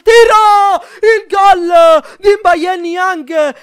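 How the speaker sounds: screaming goal call, high and loud; goal shout. Real, not acted. Goal for his team. (urlo da gol)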